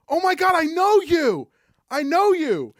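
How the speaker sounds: high, excited gasp of recognition. Retold or imitated. Stranger on a New York street, high and thrilled. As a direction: squeal like spotting a celebrity. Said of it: "some lady looks at me and goes".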